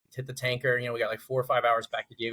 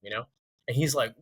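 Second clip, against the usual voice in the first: usual speaking voice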